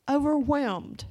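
pause, then push out "overwhelmed" with force; emphatic confession. Retold or imitated. Shopper explaining her shaking to the clerk, emphatic on "overwhelmed".

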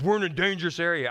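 put-on urgent little girl's alarm; imitating scared daughter. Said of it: "She goes".

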